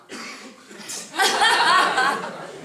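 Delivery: a big burst of real laughter, high; hearty real laugh; loud laugh. Real, not acted. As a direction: laugh out loud at your own punchline. Laughs at own joke.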